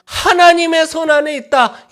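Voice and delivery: high, loud preaching crescendo; full-force preaching. Acted. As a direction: preach it out to the whole hall.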